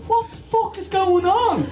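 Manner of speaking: high, baffled outburst in a put-on voice; confused audience member. Audience member baffled by the comedy, high put-on voice. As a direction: play a lost punter wondering where he's landed. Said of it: "Are you like".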